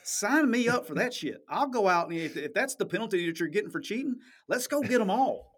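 cheerfully mocking the lax punishment; gleeful sign me up. Real, not acted. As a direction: egg everyone on, half joking. Joking about a light penalty.

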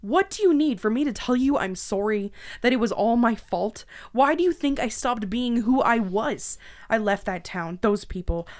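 loud, raw, pleading anger; desperate outburst. Acted. Captive shouting her guilt and flight at her captor. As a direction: throw it all at him, breaking.

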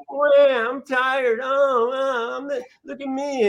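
mock a sulky player like a whining toddler; baby imitation. Coach mocks a player sulking about a foul, in a high drawn-out baby voice. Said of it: "doing some baby imitations".

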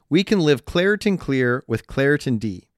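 ordinary delivery

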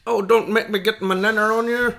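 high, loud, hammed-up Irish; mock Irish accent. Shown as a demonstration. Still attempting Irish for friend, silly high Irish accent. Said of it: "Irish is".

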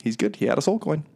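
lightly voiced cheerful clerk; chirpy reassurance. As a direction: brush it off brightly. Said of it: "And she's like".

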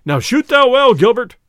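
high, loud sheriff calling out; sheriff's cry. A narrator's reading. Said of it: "cried the sheriff".

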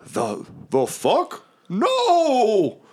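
high-pitched wail on the no; slow disbelief jumping to a high cry; upset Mahadi. Acted. Tiger-faced devil looking up at collapsing roof, upset high cry. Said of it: "he's like".

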